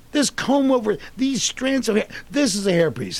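high, loud retold protest; indignant explaining. Actor defending his hairpiece to Seinfeld, flustered. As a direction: insist on it, pitched right up.

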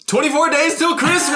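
high, booming Christmas countdown; hammed-up high-pitched holler; sudden festive announcement. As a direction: belt it out like a toy suddenly going off. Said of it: "all of a sudden he hears".

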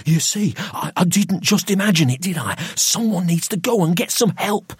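high, urgent, agitated plea; panicked pleading. A narrator's reading. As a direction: plead for help, pitch right up. Frightened man pleads for help.

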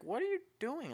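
squeaky high, incredulous; baffled high question. Retold or imitated. Viewer asking the heroine why she talks, high and baffled. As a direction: raise the pitch in disbelief. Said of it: "it's like".

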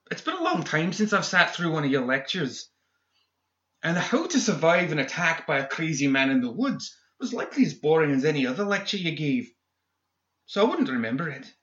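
high, snide villain; sneering villain voice. A narrator's reading. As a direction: needle him with contempt. McMorning voiced higher.

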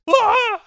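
very high mock scream; girl's scream. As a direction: imitate a shrill startled scream. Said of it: "there's a girl that goes".

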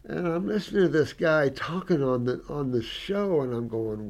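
ordinary talk

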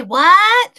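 high, loud mock yell; imitated shouted "what". Hard-of-hearing woman on the phone, imitated shout. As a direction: yell it like you can't hear. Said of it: "Yeah, she'll say".

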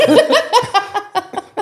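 loud high burst of laughter. Real, not acted. Big laugh.

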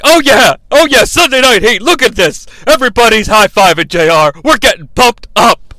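loud, high-pitched excited hollering; mock hype, punching every phrase; hyped-up Shane. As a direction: shout it like an overexcited commentator. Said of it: "Shane is like".

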